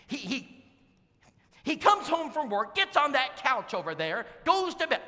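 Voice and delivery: high, loud griping wife voice; complaining wife. Retold or imitated. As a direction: gripe loudly about the lazy husband.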